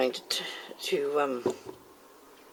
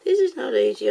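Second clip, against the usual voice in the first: louder, higher exasperated cry; 7 semitones higher, 7 dB louder than usual. Real, not acted. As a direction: blurt out the struggle. Mess while pouring resin.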